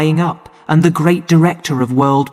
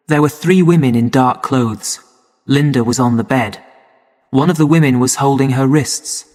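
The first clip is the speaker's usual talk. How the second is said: plain reading voice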